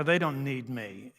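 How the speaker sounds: ordinary talk, nothing marked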